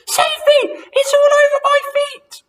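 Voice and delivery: very high, loud frightened squeal; shrieking disbelief. A narrator's reading. Wife terrified in bed, high shriek. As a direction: shriek the question. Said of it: "screamed Missus Twit".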